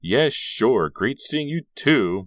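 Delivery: loud, high, drawn-out farewell; calling after. A narrator's reading. Margot to departing Carrie, calling after him. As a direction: call it slowly to someone walking off. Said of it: "Margot called after him".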